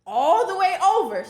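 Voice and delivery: "over" stretched high and loud; emphatic. Real, not acted. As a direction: stress how far she walked. Stressing how far she came.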